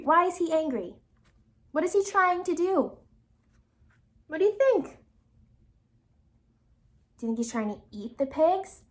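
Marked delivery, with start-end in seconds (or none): none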